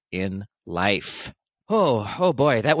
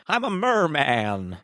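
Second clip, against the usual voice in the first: slow, higher-pitched mock proclamation; hammed-up merman; 5 semitones higher, slower than usual. Co-host playing along with the merman theory, hammed-up slow declaration. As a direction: draw out "merman" in a put-on voice.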